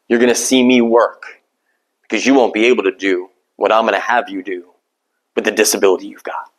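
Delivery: low, calm voice of God; the Lord speaking steadily to Paul. Acted.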